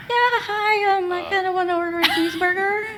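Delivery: high-pitched put-on character voice; slipping into character. Fan at drive-thru slipping into character, put-on voice. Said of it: "slipping into your character".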